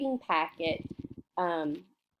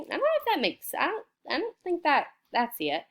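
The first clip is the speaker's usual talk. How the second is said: much higher, puzzled mock doubt; 7 semitones higher, faster than usual. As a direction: pretend to be unsure. Teacher doubts wrong word.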